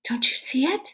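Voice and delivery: hushed unvoiced whisper. A narrator's reading. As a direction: whisper it urgently to Milligan. Rainey's urgent aside.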